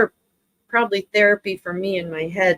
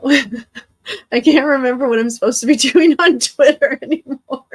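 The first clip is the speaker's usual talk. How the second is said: words bubbling with laughter, higher and louder; laughing while talking; 6 semitones higher, 4 dB louder than usual. Real, not acted. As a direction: laugh through the whole line. Laughing through line.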